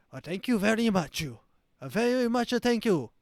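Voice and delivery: loud, high-pitched mock Chinese accent; hammed-up mimicry of a Chinese accent; fake Chinese accent. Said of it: "she starts matching his accent".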